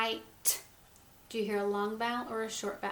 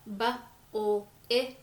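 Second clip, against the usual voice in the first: "bu-ul ink" drawn out very slowly, rising on ink; teacher sounding out a word slowly; 5 dB louder than usual. Shown as a demonstration. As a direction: stretch every sound so the child can hear it. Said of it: "you hear the I sound".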